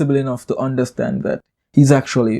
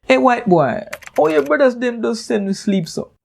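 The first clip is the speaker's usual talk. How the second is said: high, mocking Jamaican Creole taunt, raised well up in pitch; sarcastic Jamaican Creole; 8 semitones higher than usual. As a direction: Jamaican Creole heckle thrown at a bored cricket crowd's rival. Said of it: "laced with more sarcasm".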